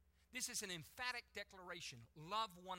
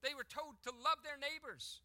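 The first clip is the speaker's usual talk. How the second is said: higher and louder, driving sermon voice; preaching at full force; 7 semitones higher, 8 dB louder than usual. Acted. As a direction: preach it out to the whole room.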